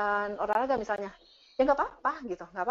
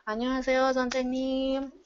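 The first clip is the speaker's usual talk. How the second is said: imitated student greeting; 5 semitones higher than usual. Student bowing to teacher in the corridor, imitated respectful greeting. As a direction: greet the teacher like a dutiful Korean student, holding the last word. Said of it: "Langsung seperti itu".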